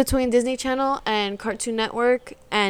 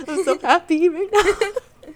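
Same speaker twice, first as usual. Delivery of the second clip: high, slow words through a laugh; laughing happy; 8 semitones higher, slower than usual. Retold or imitated. Recalling sick doctor visits, laughing happily. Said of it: "and I'm like".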